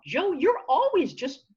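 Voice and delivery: high, swooping telling-off; playfully fed-up protest with big pitch swings; mock exasperation. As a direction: push back at the nagging inner critic, fed up. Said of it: "put them in stark contrast, you go".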